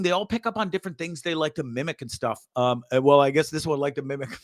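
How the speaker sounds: ordinary delivery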